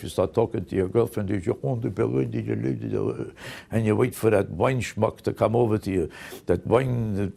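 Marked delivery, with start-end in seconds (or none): 1.3-3.3 lightly hammed-up French crooning, a little softer; mock French accent. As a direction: put on a mock suave French lilt for the girlfriend. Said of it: "you start talking like a Frenchman".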